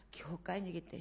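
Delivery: ordinary talk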